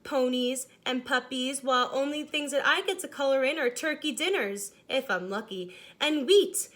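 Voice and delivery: lightly voiced crayon, a touch of complaint; grumbling beige crayon. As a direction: read the letter as a put-upon beige crayon.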